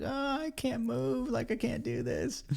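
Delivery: put-on high, soft whiny girlfriend voice; imitating girlfriend. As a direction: mimic her weak, whiny complaint. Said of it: "She's like".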